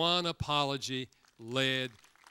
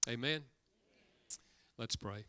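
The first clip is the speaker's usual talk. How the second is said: usual speaking voice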